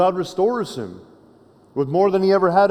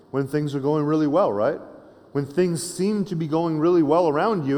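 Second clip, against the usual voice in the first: ordinary delivery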